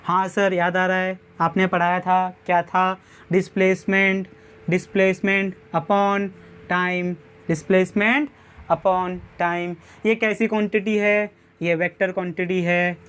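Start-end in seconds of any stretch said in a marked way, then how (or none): 3.3-9.7 very slow, word by word, for notes; slow dictation. Shown as a demonstration. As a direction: spell it out slowly so students can write. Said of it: "teacher dictating formula". (धीरे-धीरे लिखवाते हुए)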